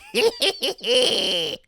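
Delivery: villain laugh. Acted. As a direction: cackle like the scheming weasel. Villain laugh after order.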